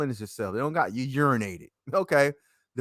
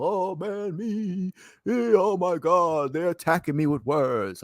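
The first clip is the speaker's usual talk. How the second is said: mock-distraught, higher-pitched wail; overemotional whining; 4 semitones higher than usual. Speaker mocking someone attacked with words, overemotional whine. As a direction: play up the wounded victim, hammed-up. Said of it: "I don't mean be emotional".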